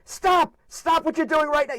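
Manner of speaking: high, panicked dad shouting stop; a father in alarm, cutting his son off; freaking-out dad. Retold or imitated. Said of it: "He was freaking out".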